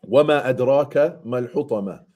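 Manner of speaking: fast melodic Arabic recitation; تلاوة مرتلة; Quran recitation. Acted. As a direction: chant the verse in tajwid style.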